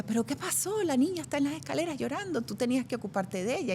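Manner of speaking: put-on a mother scolding the babysitter; a hint of a worried mom. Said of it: "le dicen a mi bisabuela".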